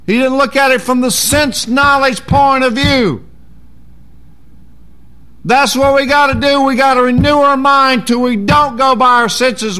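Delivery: high, loud fervent preaching; preaching at full force. Real, not acted. Pastor presses the congregation to renew their minds, preaching loud. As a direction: drive it home like a revival preacher.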